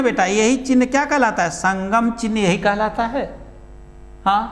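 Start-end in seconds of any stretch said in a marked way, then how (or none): none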